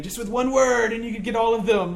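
a zealot fired up and urging. Retold or imitated. A zealot urges Jesus to rally the crowd, fired up. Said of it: "right, fired up".

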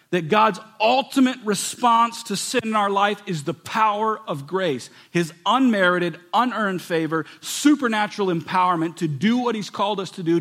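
loud, high pulpit preaching; fervent preaching. Acted. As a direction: preach it hard, pushing each line. Preaching at full force.

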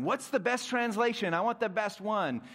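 eager student. Retold or imitated. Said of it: "I asked my Greek teacher".

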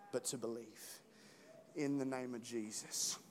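soft, hushed prayer. Acted. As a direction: pray quietly over the room. Leads closing prayer.

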